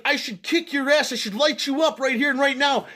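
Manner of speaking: high, loud furious threat; threatening yell. Retold or imitated. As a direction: threaten to beat him up. Stranger threatens him.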